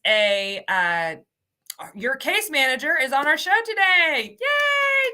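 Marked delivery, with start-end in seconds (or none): 4.4-5.1 high, bright cheer; excited yay. Real, not acted. Host cheering her colleague on the show, excited yay. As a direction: squeal it with delight.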